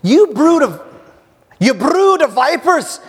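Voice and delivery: loud, high-pitched denunciation; John the Baptist. Acted. John rebukes the crowds coming out for baptism, loud denunciation. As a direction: cry it out high and loud like a wild prophet. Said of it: "what John says".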